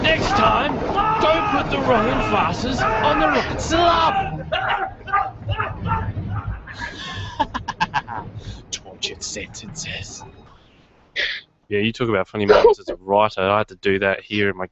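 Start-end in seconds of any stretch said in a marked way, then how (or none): 0.0-4.4 high, loud drawn-out scream; slow hammed-up yell; screaming catchphrase. Shouted segment catchphrase, screaming. 4.5-8.7 hammed-up shrieking laugh; manic scream-laugh. Screaming laugh after catchphrase. 8.7-10.2 eerie whisper. Acted. Segment title after scream.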